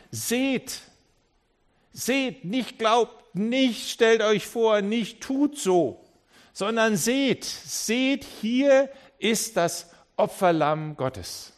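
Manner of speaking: high, loud prophetic cry, slowing at the end; Johannes proclaiming. Retold or imitated. Johannes announcing the Lamb of God to the crowd, ringing prophetic cry. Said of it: "dieser Johannes sagt".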